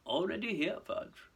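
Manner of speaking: high start, softly dropping away; smooth, satisfied greeting; approving. Acted. Malfoy finding Fudge already there, approving. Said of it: "he said approvingly".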